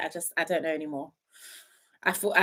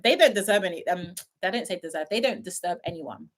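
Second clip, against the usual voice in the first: ordinary talk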